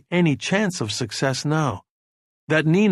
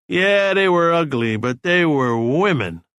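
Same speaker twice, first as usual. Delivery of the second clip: put-on loud drunken declaration, higher then slowing; 4 semitones higher, 4 dB louder than usual. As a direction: boom it out across the room. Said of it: "said very loud".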